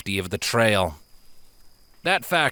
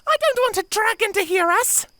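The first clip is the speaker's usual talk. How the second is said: high frightened little filly; plead, scared of being heard. Acted. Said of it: "Sapphire begged".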